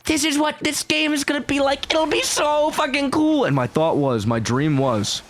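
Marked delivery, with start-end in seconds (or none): 0.0-3.4 put-on high, excited little-boy voice. The little boy inside him dreaming of the game, high and eager. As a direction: voice the kid inside, high and thrilled. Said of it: "The little boy inside of me broke through the walls and was like".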